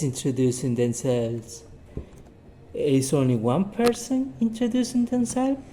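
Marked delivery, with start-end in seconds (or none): 2.7-5.6 high, rising quiz question; raised voice of a teacher prompting the class; teacher's quiz question. Shown as a demonstration. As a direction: ask it brightly, pulling for an answer.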